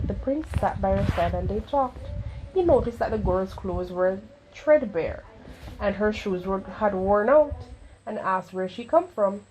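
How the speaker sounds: usual speaking voice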